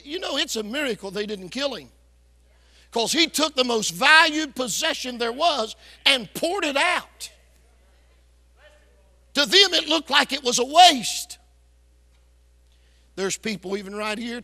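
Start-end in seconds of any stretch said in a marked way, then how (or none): 2.9-11.4 louder and higher, pressing the point home; rising preaching fervor. Real, not acted. Preacher to the congregation, louder and higher, full preaching force. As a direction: build it up till the last line rings out.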